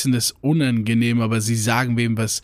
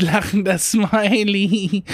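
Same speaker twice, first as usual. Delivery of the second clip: higher, slow singsong "laughing smiley"; mocking emoji tag; 6 semitones higher, much slower than usual. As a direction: tack it on in a silly drawn-out lilt. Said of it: "die das hinter jeden Satz machen". (spöttisch gedehnter Singsang)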